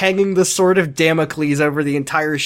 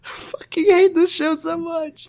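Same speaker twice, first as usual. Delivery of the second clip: exasperated whine; 12 semitones higher than usual. Real, not acted. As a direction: moan it like you can't take another episode. Groaning about the show they recap.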